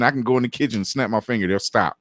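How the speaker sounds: usual speaking voice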